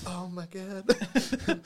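drawn-out deadpan disbelief; slow disbelief. Retold or imitated. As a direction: stretch it out, unimpressed. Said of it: "I'm like".

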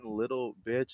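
soft, deadpan script reading; first person. Acted. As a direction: read the insult quietly, like a listed line. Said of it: "first person".

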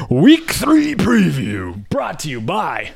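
slow booming announcer intro; radio announcer voice. Acted. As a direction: draw it out like a radio host. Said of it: "I did my radio voice".

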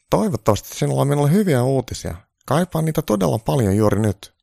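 a needy caricature of Trump; high Trump voice. Acted. Trump begging Santa for good news, high-pitched.

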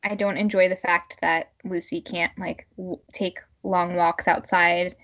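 ordinary talk, nothing marked

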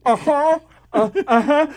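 high, loud imitated muffled grunts; mouth stuffed. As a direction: answer as if through a sock in the mouth. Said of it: "stuffed it in my mouth".

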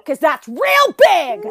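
pointed accusation. Retold or imitated. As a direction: lean on it, slowly. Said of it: "I lift up her shirt and I go".

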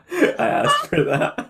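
giggling through the words; laughing hard while speaking. Real, not acted. Laughing after the Crankies joke.

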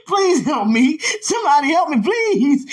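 high, loud wail through laughter; imitating a helpless laughing fit; mock helpless pleading. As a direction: beg for help while cracking up. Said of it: "That's how I was".

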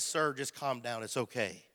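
soft, low reassurance; retold soothing. Doctor calms a frantic husband, retold soothing.

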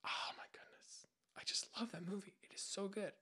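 breathless whisper of awe. Real, not acted. Gushing to her friend about the movie, in a whisper. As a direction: whisper it like a secret love.

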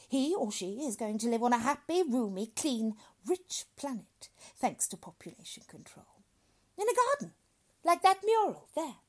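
loud, high oratory; grand doctor. Acted. Doctor promises father a bright future, grandly. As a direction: proclaim it to him expansively.

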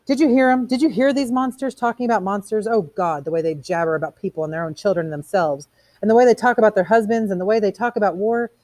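outraged rant. Acted. Montag appalled by the women's jabber, ranting.

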